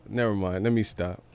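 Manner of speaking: usual speaking voice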